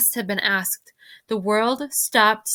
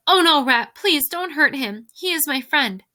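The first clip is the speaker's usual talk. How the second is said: high, raised plea; crying out; 6 semitones higher, 5 dB louder than usual. A narrator's reading. The princess begs the rat not to hurt her friend, crying out. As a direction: plead urgently for a friend's life. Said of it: "The princess cried".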